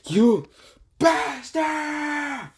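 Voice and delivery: high, long drawn-out shout; Boruto's angry cry. Acted. Boruto cursing his enemy, high angry shout. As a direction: yell it as a furious, stretched-out battle cry. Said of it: "And Boruto says".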